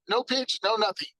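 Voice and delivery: usual speaking voice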